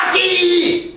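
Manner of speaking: put-on kids shouting. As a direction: pipe up like an eager kid. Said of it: "Y los niños gritan".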